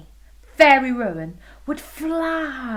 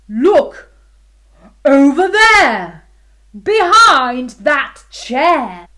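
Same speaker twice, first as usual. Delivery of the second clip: loud king voice; 4 semitones higher, 12 dB louder, slower than usual. Acted. King voice continues.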